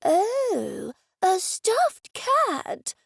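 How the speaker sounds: put-on high, slow, thrilled old man voice; light up with delight, still frail; the old man hears he gets a stuffed cat, thrilled; excited old man. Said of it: "Mister Dennison sounded excited".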